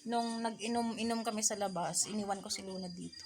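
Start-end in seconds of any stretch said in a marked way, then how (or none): 1.2-3.2 unvoiced whisper. Real, not acted. Whispering near sleeping baby.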